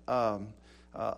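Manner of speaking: ordinary delivery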